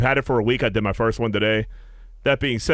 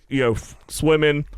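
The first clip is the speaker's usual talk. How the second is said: usual speaking voice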